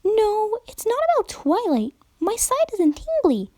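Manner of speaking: very high, insistent chirp; high bubbly pony. A narrator's reading. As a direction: shake her head and insist. Pinkie insists.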